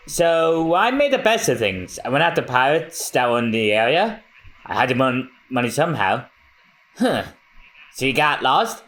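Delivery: ordinary delivery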